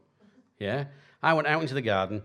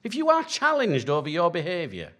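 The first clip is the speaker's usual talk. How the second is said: raised, higher preaching voice; 8 semitones higher than usual. Acted. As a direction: drive it home from the pulpit. Preaches on church boundaries.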